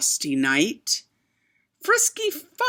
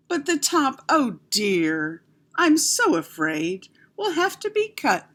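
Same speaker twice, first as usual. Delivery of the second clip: sighing; 6 semitones higher than usual. A narrator's reading. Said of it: "sighed Miss Adelaide".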